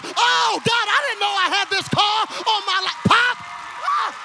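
high, shouted preacher's exclamations; fervent, escalating pulpit shouts; full-force preaching. Acted. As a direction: punch out each revelation at a revival pitch. Preaches acted realisations at full force.

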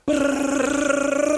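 high rattling gunfire sound; machine gun noise. Retold or imitated. Imitated machine gun fire.

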